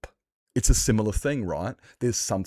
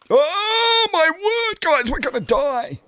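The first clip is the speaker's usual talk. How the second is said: panicked; 6 dB louder, slower than usual. Real, not acted. Sudden danger in game.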